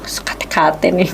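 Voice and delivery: hushed whisper to herself; barely voiced, thinking it; inner thought whispered. To herself at the bad news, whispered. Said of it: "Dalam hati ya".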